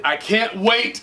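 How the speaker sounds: eager, lifting at the end; bubbling with anticipation; excited. Real, not acted. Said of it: "so excited".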